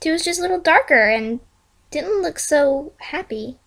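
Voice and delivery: ordinary delivery, nothing marked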